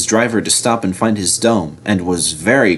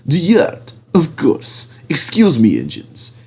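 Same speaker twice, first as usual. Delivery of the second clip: oily Diesel; 7 semitones higher than usual. A narrator's reading. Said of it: "said Diesel".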